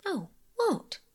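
high, quick eager question; lightly voiced eager girl. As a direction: jump in, keen to know. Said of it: "said Phyllis eagerly".

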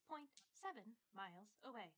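little GPS robot voice; robot voice reading the distance. Retold or imitated. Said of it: "like, little robot voice".

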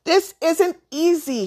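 put-on pointedly loud boyish protest. A narrator's reading. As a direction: announce it loudly so someone hears. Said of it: "he explained in a loud voice".

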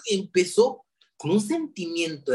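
normal talking voice

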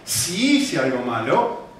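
SI held long before HICE ALGO MALO; slow, grudging SI; distancing stress on SI. Shown as a demonstration. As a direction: stretch SI as if barely conceding. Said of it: "como que te, que está muy lejos de mí".

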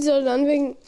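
ordinary delivery

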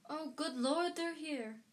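high, flustered panic; frantic. A narrator's reading. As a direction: blurt it in a fluster. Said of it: "said Aunt Petunia frantically".

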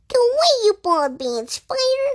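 high, loud scolding mouse voice; tidy mouse scolding a spider, high and indignant. A narrator's reading. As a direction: chase him out, prim and high.

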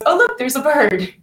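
lightly voiced scatterbrain; airy distractor. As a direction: play the distractor pointing elsewhere. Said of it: "the distractor goes".

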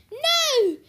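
high, loud drawn-out cry; scream. A narrator's reading. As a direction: scream it in horror. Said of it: "Sears screamed".

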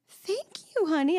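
high, tender thank you; surprised and moved; touched thanks. Retold or imitated. Mother retelling her touched reply to her daughter. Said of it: "I just looked at her and I went".

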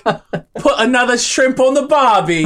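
high, slow, hammed-up Australian accent that drifts British; mock Australian accent, laughing; a failed Australian accent slipping into British; bad Australian accent. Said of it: "saying something wildly offensive to Australians".

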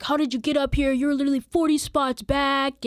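loud, high imitation of an angry man; retold yelling. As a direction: mimic someone yelling an accusation. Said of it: "he just kinda starts yelling at us".

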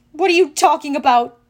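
loud, sharp question; demanding. A narrator's reading. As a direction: demand an answer. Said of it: "I demanded".

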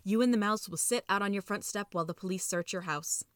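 ordinary delivery

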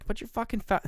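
high-pitched mock Australian; poor Australian accent. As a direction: put on a bad, high Aussie voice. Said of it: "really poor Australian accent".

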